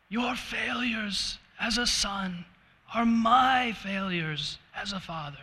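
hammed-up emotional line, drawn out slowly; dramatic father. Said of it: "A little dramatic on my part there".

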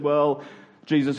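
ordinary talk

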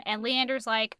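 ordinary talk, nothing marked

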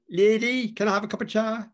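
put-on sing-song Cork accent, calling at the door; Cork lilt. Dan asking the mother for tea, high Cork lilting call. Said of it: "he said it in that distinctive Cork lilting tone".

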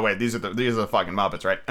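ordinary delivery

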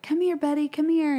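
high, coaxing call; retold holler. Parent calling the crying child over, a mild retold holler. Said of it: "So I holler at him".

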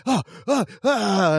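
high hammed-up agony grunts; fake wrestling groans. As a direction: ham up a wrestler's fake pain cries. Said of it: "fake wrestling sounds".

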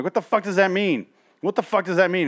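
exasperated outburst. Retold or imitated. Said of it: "'cause I'm like".

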